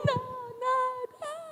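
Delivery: high, soft mock wail; child's wail. Child pulled from mother's lap.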